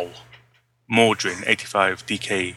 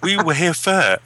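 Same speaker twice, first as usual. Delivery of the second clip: mock indignant; 6 semitones higher, slower than usual. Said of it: "play the colonial card now".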